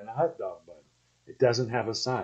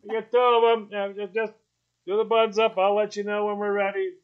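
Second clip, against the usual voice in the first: raised, higher voice calling to the cook; grandpa yelling out; 8 semitones higher than usual. Retold or imitated. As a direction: call out cheerfully to the grill cook like grandpa. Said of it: "he'd always yell out and go".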